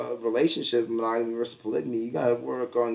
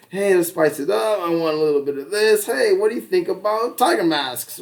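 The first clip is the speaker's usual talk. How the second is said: higher, playful mock pitch to a partner; lightly acted upbeat suggestion; pretend frank proposal; 6 semitones higher, 4 dB louder than usual. Models a frank bedroom conversation.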